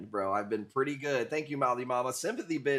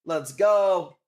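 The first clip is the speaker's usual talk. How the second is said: louder, higher cheer on go; hype shout; 6 semitones higher, 11 dB louder than usual. Real, not acted. Streamer celebrating a sale, loud hype cheer. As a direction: shout it like a win.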